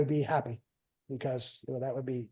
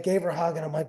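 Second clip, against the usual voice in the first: ordinary delivery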